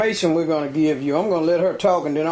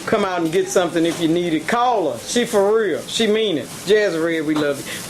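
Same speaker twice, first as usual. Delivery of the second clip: ordinary talk, nothing marked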